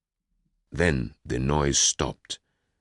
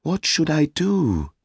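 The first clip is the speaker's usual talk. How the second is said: desperate; 14 semitones higher, 5 dB louder than usual. Acted. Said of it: "she asked me desperately".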